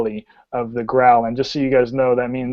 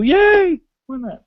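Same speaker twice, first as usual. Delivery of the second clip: high-pitched mock yay; sarcastic little high cheer. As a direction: cheer the mishap in a squeaky pitch. Mock cheer after die falls.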